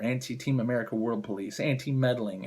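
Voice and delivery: ordinary delivery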